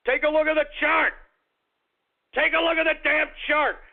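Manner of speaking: high, emphatic outburst; worked-up exclamation. Real, not acted. Host urging listeners to see the chart, worked up. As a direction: urge them to look, pitch jumping up. Worked up over price jump.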